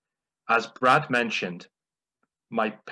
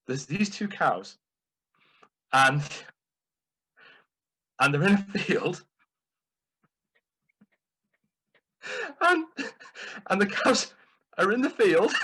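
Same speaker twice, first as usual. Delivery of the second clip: higher and louder, breaking up with laughter; can't get past the setup for laughing; dad laughing through the joke; 9 semitones higher, 4 dB louder than usual. Retold or imitated. Son imitating his dad cracking up before the punchline, giggly. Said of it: "my dad's version".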